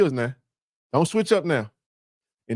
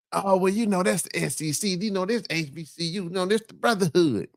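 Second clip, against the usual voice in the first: soft, sing-song mimicry of rival fans; lightly mock their excuses in a hushed voice; quieter, sneering imitation of the other side; mocking quote; 5 dB quieter than usual.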